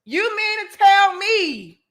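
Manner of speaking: normal talking voice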